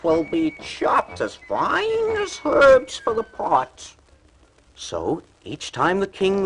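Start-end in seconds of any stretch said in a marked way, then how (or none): 0.0-3.9 high, loud and slowing threat; threatening cat voice. A narrator's reading. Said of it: "he told the workers in the fields".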